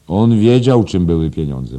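loud, high opening that settles; emphatic schoolmaster. Acted. Headmaster telling teacher about Shakespeare and money, loud and emphatic. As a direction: drive the point home forcefully.

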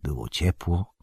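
usual speaking voice

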